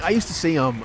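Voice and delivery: ordinary talk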